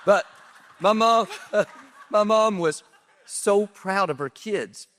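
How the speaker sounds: a fond laugh breaking into the words; laughing through tears. Real, not acted. [name] to Chad, recalling his proud mom: laughing.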